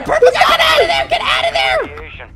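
high laughing yell at teammate. Real, not acted. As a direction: shout it, half laughing. Calling to teammate in danger.

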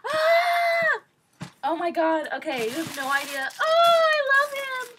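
high, loud shrieks of delight; overjoyed squeal. Real, not acted. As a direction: squeal at the gift you love most. Opening a Rilakkuma plush gift.

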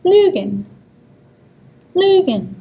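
Lügen with a long rounded ü; German pronunciation. Shown as a demonstration. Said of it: "Here is an example word".

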